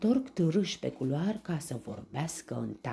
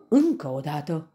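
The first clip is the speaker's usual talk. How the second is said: loud, higher shout; shouted command; 4 semitones higher, 10 dB louder than usual. A narrator's reading. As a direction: call out the next strike. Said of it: "strigă Tafiti". (strigăt poruncitor)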